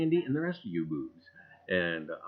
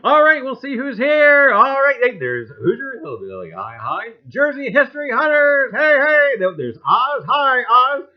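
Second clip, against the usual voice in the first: excited greetings; 12 semitones higher, 14 dB louder than usual. Real, not acted. Greeting chat viewers loudly.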